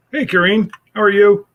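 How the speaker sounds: high, loud happy hello; excited greeting. Real, not acted. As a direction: call out the hello brightly. Greeting a viewer who joined.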